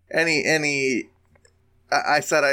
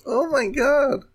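shocked gasp; 14 semitones higher, slower than usual. Real, not acted. Reacting to painful old lenses.

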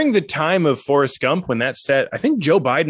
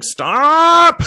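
high, loud drawn-out cry; mock-distressed scream; 6 dB louder than usual. Comedian as onlooker begging a couple to stop, mock scream. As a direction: yell it in hammed-up distress.

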